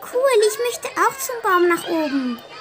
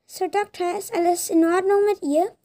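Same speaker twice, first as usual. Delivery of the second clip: usual speaking voice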